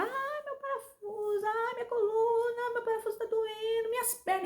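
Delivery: high, thin wail held under the voice; soft, slow, high-pitched tearful moaning; crying in pain. Retold or imitated. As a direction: reenact a drawn-out, whimpering cry. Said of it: "eu comecei a chorar".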